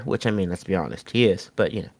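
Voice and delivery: quiet aside. Real, not acted.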